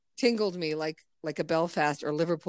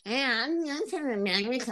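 high, mocking tourist voice; high-pitched send-up of Americans abroad; loud American tourist; 6 semitones higher, 5 dB quieter than usual. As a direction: mimic an obnoxious American in a high, small voice. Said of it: "Fucking Americans walking through the streets going".

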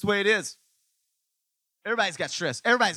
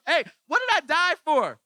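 much higher, loud dismay; exasperated Jesus; 14 semitones higher, 7 dB louder than usual. Retold or imitated. Pastor voicing Jesus dismayed at his people, high and loud. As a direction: plead like a let-down Jesus. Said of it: "When Jesus is going".

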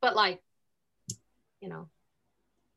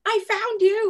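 put-on excited drunk; 10 semitones higher, 7 dB louder, slower than usual. Drunk man shaking her.

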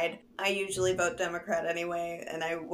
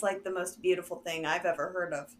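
ordinary talk

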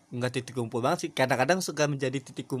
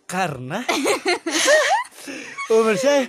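high laughing tease; 11 semitones higher, 10 dB louder than usual. Real, not acted. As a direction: giggle through the words. Teasing about age, laughing.

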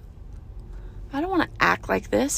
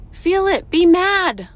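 emphatic urging; 8 semitones higher, 8 dB louder, slower than usual. Real, not acted. Urging viewers to feel anger.

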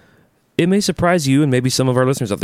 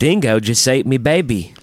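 slow mock Australian accent; hammed-up Australian quote. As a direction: draw it out like an Aussie. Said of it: "in an Australian accent".

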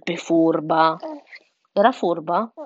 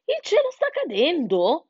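high, questioning turkey voice; gobbling turkey; 10 semitones higher than usual. A narrator's reading. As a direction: repeat the alarm as a flustered turkey. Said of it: "gorgogliò Ernesto il tacchino". (tacchino che gorgoglia con voce alta)

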